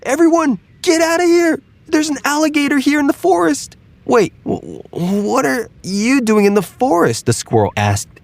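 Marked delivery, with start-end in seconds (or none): 0.0-3.8 very high, panicked alarm call; squirrel yelling. Acted. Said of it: "and yelled". 4.1-7.2 high, startled questioning; a squirrel tripping over its words; stammering squirrel. Acted.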